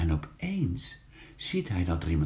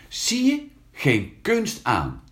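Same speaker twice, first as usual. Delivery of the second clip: cocky, the first word much higher, then drawn out slowly; boasting; 7 semitones higher, slower than usual. Acted. Older brother bragging after his jump, slow and cocky. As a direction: show off to the little brother.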